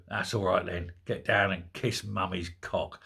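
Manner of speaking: mock cellmate. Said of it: "the guy will go".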